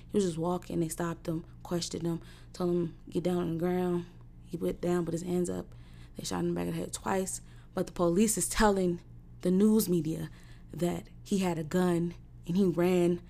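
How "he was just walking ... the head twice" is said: quiet grief. Real, not acted. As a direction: hold it down, barely above a murmur.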